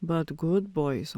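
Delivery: normal talking voice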